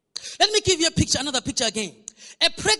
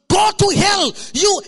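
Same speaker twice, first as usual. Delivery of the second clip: loud, raised outburst at a husband; cursing in labour; 4 semitones higher, 7 dB louder, slower than usual. Acted. Women giving birth tell husbands off, acted angry shout. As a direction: lash out mid-contraction. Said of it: "tell their husbands".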